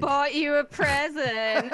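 loud, high, drawn-out singsong; hammed-up excited announcement of a gift; mock gift-giving girlfriend. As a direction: call it out slowly, teasing and laughing. Co-host plays the gift-giving girlfriend.

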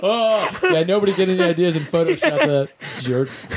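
words riding on leftover laughter; recovering from a laughing fit; real laughing. Real, not acted. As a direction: still chuckling while warning listeners. Still laughing at the Photoshop joke.

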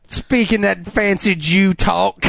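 slow, mock Alabama relative; Alabama accent. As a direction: tease in a drawn-out Alabama country accent. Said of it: "goes down to Alabama to visit his family".

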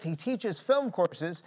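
ordinary delivery

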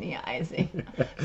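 Apneizing said slowly, syllable by syllable, as AP-nee-EYE-zing; careful slow pronunciation. Shown as a demonstration. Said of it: "You pronounced my new word".

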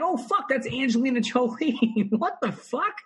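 high, slow, amazed disbelief; star-struck shock. Retold or imitated. Bartender spots Angelina Jolie, slow high shock. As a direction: gasp it like you can't believe your eyes. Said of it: "I'm like".

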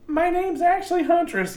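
high mocking quote voice; mock character line. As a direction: parody the heroine's catchphrase. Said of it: "that joke was".

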